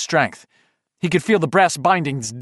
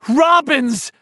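much higher, loud cry into the dark; desperate scream; 11 semitones higher, 4 dB louder than usual. Acted. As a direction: hurl the word at the darkness. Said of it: "he screamed into the darkness".

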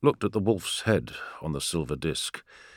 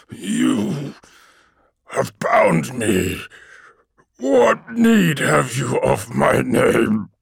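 demon voice; 3 semitones higher, much slower than usual. Acted. Bound demon speaks.

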